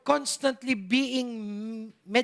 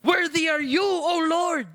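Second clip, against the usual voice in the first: loud, high cry of worship; shouted praise; 7 semitones higher, 5 dB louder than usual. Shown as a demonstration. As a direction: shout it like heaven is shaking. (sigaw ng papuri nang buong lakas)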